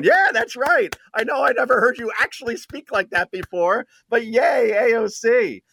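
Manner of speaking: high-pitched, gushing fan imitation; mock cheering crowd. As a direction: play a crowd whooping its approval, mockingly. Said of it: "you hear the crowd going".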